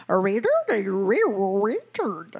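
exaggerated swooping Wisconsin accent. Imitating friend Shelley, overdone Wisconsin accent. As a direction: garble it in mock Wisconsin vowels. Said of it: "It's more like".

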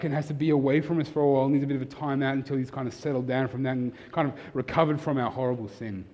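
ordinary talk, nothing marked

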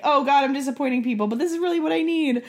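high, loud, fretful inner voice; anxious inner voice. Retold or imitated. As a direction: wring your hands out loud. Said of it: "being like".

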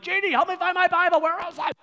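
high, frantic call for help; imitating dad calling out. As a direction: holler to the wife like a flustered dad. Acts dad calling wife for lost Bible.

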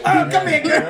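high, laughing mock-adoring cry. Husband hugging the TV with his wife on it, mock adoring. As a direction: gush playfully at the screen.

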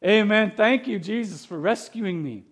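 mock-elated praise, like a happy churchgoer; joyful amen. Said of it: "We're thinking".

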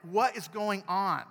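slow, loud, frustrated cry; frustrated outburst. Pretend. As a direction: let out the irritation slowly. You're so frustrated and irritated.

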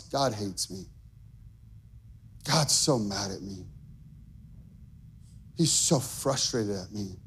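low, soft despairing voice; inner thought. Retold or imitated. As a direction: murmur it like a private fear.

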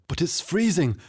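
panicked tavern owner pleading to stay aboard; horrified pleading. A narrator's reading. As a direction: beg not to be thrown in the sea. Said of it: "eyes widened in horror".

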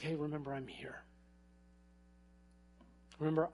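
gentle God. Retold or imitated. God gently speaking to Tony.

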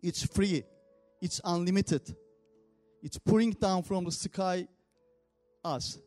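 ordinary talk, nothing marked

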